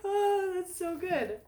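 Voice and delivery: high, slow, laugh-choked; laughing with delight. Real, not acted. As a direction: gush through laughter. Laughing with joy.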